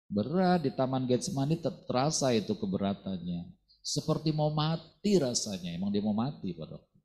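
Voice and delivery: ordinary talk, nothing marked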